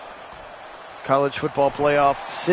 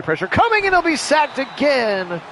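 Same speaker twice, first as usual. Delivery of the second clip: excited sack call; 12 semitones higher, 6 dB louder than usual. Acted. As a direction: pitch leaps way up, louder, calling the sack. Second sack call, live.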